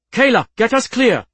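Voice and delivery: high, loud emergency command; commanding cry in battle; urgent shouted order. A narrator's reading.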